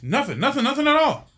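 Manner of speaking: imitating a flustered woman caught out; nervous denial. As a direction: squeak out the denial like you're hiding something. Woman hiding bird denies noise.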